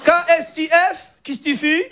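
put-on high-pitched Moroccan Arabic accent; exaggerated North African accent in French; Hicham, Moroccan accent. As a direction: cousin with a thick Moroccan accent. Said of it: "t'as l'accent".

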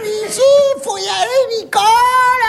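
high-pitched coaxing of Nicole; insistent, drawn-up pleading; insistent urging. Retold or imitated. Insistent spouse urging her up the crane.

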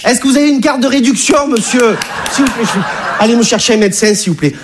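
high, fussy conductor voice; mock ticket inspector fussing; train conductor voice. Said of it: "en disant".

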